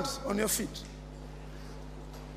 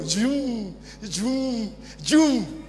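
drawn-out hummed engine noise; playful vroom of a car; imitated vehicle zoom; 3 dB quieter, much slower than usual. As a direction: mimic a car revving along. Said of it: "begin to drive his vehicle".

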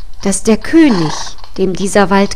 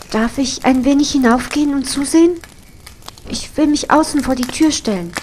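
put-on timid girl; 5 semitones higher, faster than usual. Girl asks the cook timidly.

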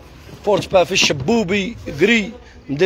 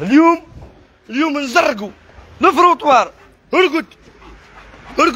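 shouted commands; 6 semitones higher than usual. Real, not acted. Owner orders the pet bird to lie down, shouting high.

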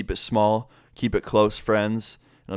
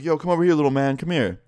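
higher, lightly mimicked dude beckoning a kid over; retold call-over, pitched up; 7 semitones higher than usual. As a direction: imitate someone calling over a little kid. Imitated dude calling kid over.

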